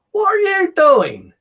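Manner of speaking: high, loud, drawn-out mock shriek; dairymaid. Dairymaid walking in on the farm boy, shrieking in mock shock. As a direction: screech it as a hammed-up shocked woman. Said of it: "the dairymaid walks in".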